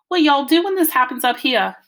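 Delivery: high, loud Southern fretting; worried Southern mama. A narrator's reading. Mama to Dad when she got worried, Southern accent. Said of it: "Mama started talking Southern style when she got worried".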